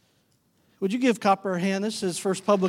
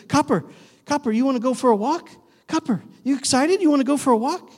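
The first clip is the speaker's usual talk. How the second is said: bright, eager call to the dog about a walk; excited dog-talk; 4 semitones higher, 4 dB louder than usual. Shown as a demonstration. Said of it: "he responds to our tone".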